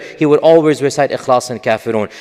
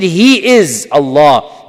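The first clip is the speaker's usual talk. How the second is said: slow, weighty stress on He, drawn out; emphatic translation; 4 dB louder, much slower than usual. Shown as a demonstration. Teacher stressing "He is Allah" to show emphasis, slow and weighty. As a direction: lean hard on "He is Allah," slowly, like a revelation. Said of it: "it makes you pay attention".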